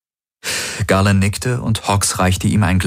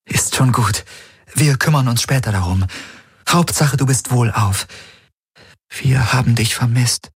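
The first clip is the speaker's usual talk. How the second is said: put-on raised higher, gentle young woman; Kirai's voice; 6 semitones higher than usual. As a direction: speak as Kirai, caring for the wounded.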